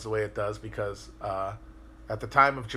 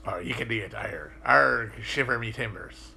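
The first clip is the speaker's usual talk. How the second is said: pirate accent. Shown as a demonstration. Showing how Cornish sounds like pirates, pirate accent. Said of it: "closest thing to a pirate accent".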